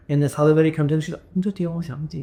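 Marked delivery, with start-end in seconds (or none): 1.3-2.2 softer imitation of a Chinese-speaking woman; put-on Chinese auntie voice.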